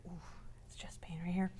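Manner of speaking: hushed, whispery mock ache; pained patient. Patient showing where chest pain is, pained whisper.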